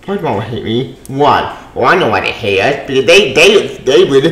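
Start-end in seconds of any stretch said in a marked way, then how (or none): none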